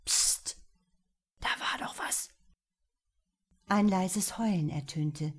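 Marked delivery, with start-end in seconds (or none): 0.1-2.3 a sharp, breathless whispered hiss; startled hiss. A narrator's reading. Lukas hears a noise outside the hut, startled hiss. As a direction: hush him, frightened by a noise. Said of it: "zischte erschrocken".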